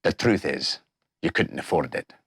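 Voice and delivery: lightly voiced low shopkeeper; low tailor voice.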